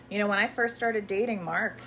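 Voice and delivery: normal talking voice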